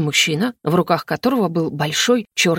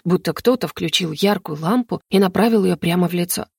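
plain reading voice

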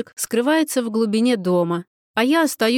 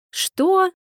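shocked; 9 semitones higher than usual. A narrator's reading. Heroine shocked by job offer.

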